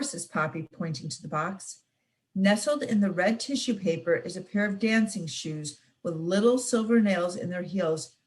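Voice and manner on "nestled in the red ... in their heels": ordinary delivery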